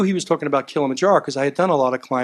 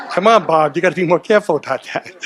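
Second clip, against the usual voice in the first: laughing tease; 4 dB louder, faster than usual. Real, not acted. Teasing Bob with a laugh.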